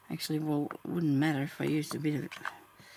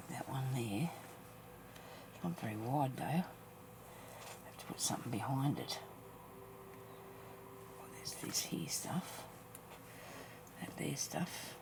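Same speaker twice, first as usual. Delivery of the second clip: slow soft whisper; 9 dB quieter, slower than usual. Real, not acted. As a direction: murmur it under your breath. Quiet musing over materials.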